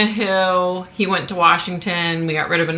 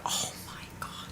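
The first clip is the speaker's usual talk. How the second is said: breathy whisper, almost no voice; whispered aside; 19 dB quieter than usual. Real, not acted. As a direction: drop to a whisper for the oh my God. Aside about back pain.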